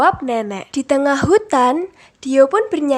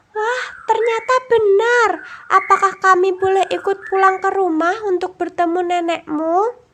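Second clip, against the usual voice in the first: very high, delighted tiny butterfly; high happy butterfly asking to come along; 7 semitones higher than usual. A narrator's reading. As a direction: squeak with glad surprise. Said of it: "tanya kupu-kupu".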